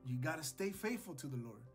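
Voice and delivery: usual speaking voice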